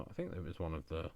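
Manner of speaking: ordinary talk, nothing marked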